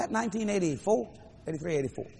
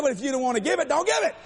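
high, loud mock-angry retort; mad retort; 8 semitones higher, 4 dB louder than usual. Preacher voicing God about the offering, mock mad retort. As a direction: snap it back, rising on "don't give it". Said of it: "you don't have to get mad".

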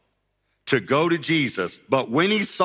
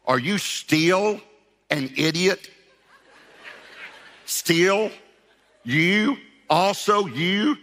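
slow, high, mocking scorn; a mocking caricature of Jesus at a slow drawl; harsh exaggerated Jesus; 7 semitones higher, much slower than usual. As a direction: sneer each word in disbelief. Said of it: "Jesus didn't say it like that".

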